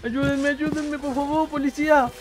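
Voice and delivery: high squeaky pleading voice; mock cry for help. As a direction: squeal for the police like a victim. Mock cries for police help. (voz chillona pidiendo auxilio)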